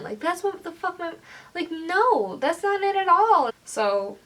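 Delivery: high, heated protest; indignant outburst. Real, not acted. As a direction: push back hard, exasperated. Indignant rebuttal.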